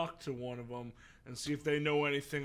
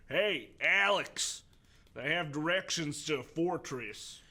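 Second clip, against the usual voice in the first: raised, louder call across a distance; 4 semitones higher, 6 dB louder, slower than usual. Acted. Steve to Alex across the way, calling out. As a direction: holler the name slowly, then the news. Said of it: "called out to her".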